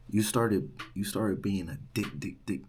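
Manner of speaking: imitated stutter, stuck on "dick". Student insulting the RA, stuttering. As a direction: trip over the start, then repeat "dick" haltingly. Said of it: "He started stuttering".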